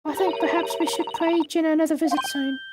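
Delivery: put-on high, polite young woman voice; young lady voice. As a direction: speak as a prim, proper young assistant. Voices Susato character.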